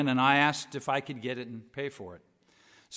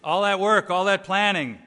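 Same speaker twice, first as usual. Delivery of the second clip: ordinary talk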